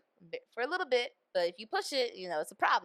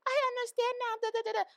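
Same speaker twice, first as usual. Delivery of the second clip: very high, singsong dismissive mimicry; mock sappy couple; 3 dB louder, faster than usual. Mocking the couple's apology, high singsong. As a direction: squeak it like a sappy cliche.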